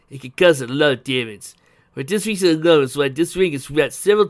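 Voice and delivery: loud, emphatic character explanation; Uncle Chuck, earnest inventor. Acted. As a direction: warn and explain forcefully. Voices Uncle Chuck explaining danger.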